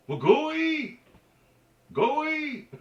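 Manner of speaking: very high, loud holler; mimicked man's retort. Man answers a botched greeting, mimicked very high and loud. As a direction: fling it back high and loud, jokingly. Man's exasperated reply acted out.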